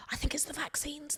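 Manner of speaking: whispered mock conspiracy theory; conspiratorial whisper. To listeners, joking that vaccines are to blame, in a whisper. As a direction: breathe it like a secret joke.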